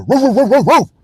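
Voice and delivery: high, quick barks of a dog; mock dog barking. Vlogger mocking a prayer, voices a barking dog. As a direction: bark it out like a silly dog. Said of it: "and the dog said".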